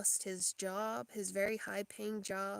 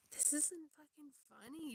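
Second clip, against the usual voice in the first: higher-pitched, softer mock outrage; retold indignant protest; 7 semitones higher, 5 dB quieter than usual. Patient retelling their protest to laughing nurses, mildly indignant. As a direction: protest in a raised pitch, without shouting. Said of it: "they, I'm like".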